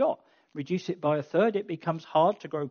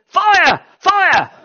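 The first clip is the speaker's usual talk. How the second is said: high, loud shout of "Fire, fire!"; alarm shout; 13 semitones higher, 13 dB louder than usual. Shown as a demonstration. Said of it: "a bit like us saying".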